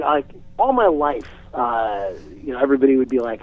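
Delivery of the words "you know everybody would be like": ordinary talk, nothing marked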